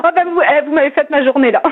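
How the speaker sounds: ordinary delivery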